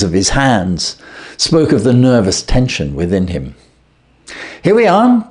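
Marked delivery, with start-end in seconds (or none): 4.6-5.3 bright, much higher and louder greeting; cheerily. Acted. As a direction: welcome them in cheerfully. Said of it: "said Holmes cheerily".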